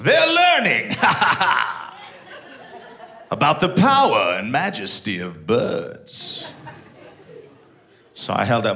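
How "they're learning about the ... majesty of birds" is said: sudden high, shouting announcer voice breaking into laughter; grand proclamation. Retold or imitated. Kylo Ren costumed guy to the comic: grand laughing announcement. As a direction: belt it like a grand showman, laughing.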